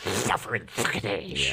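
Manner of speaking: slow, soft duck lisp; Daffy Duck voice. Retold or imitated. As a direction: drawl it like Daffy. Daffy catchphrase parody.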